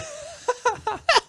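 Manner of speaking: a short high-pitched squeal of laughter. Real, not acted. Amused by the video editor joke, squealing laugh.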